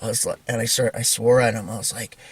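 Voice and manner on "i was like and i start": usual speaking voice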